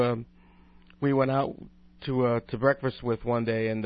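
usual speaking voice

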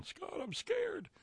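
high, slow, soft mock-frightened whine; a high, hushed, drawn-out mock plea to Scott; mock scared. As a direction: play the scared little kid, hammed-up. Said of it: "I don't spend a lot of time on this program going".

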